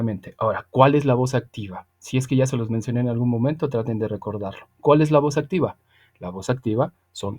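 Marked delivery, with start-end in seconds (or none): none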